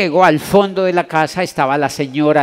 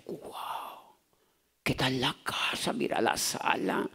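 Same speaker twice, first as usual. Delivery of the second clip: hushed, breathless awe at the fancy room; a stunned whisper in the rich man's hall; awed whisper; 14 dB quieter, slower than usual. Retold or imitated. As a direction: whisper it as if the house might hear you. Awed at the big house.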